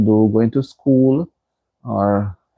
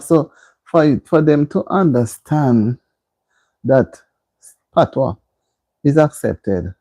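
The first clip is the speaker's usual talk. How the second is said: usual speaking voice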